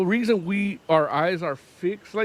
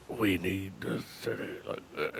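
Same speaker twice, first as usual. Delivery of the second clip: very low, quiet gravelly growl; sigh, then rumble it softly from deep down; gravelly Kingpin; 10 semitones lower, 12 dB quieter than usual. Retold or imitated. Imitating Kingpin answering everybody, low gravelly murmur. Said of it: "a little bit of that gravelly".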